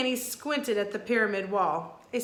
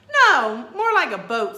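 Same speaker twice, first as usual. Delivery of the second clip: eager correction; 5 semitones higher, 12 dB louder than usual. Acted.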